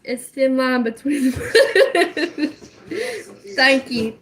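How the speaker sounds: words squeezed out through laughter; slow, high, loud, cracking up; laughing. Real, not acted.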